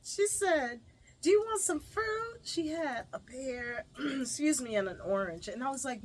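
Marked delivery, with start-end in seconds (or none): 1.2-2.4 high, louder offering voice; lightly acted, pitched-up question; imitated friendly offer. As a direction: mimic a generous stranger offering something. Imitates lady offering fruit.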